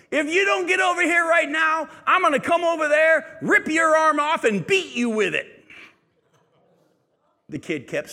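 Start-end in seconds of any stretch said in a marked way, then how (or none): 0.1-5.6 much higher, much louder threatening shout; furious parent shout. Retold or imitated. Parent threatens the swinging kid across Taco Bell, furious shout. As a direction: scream the threat across the restaurant. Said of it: "the parent yelled across the Taco Bell".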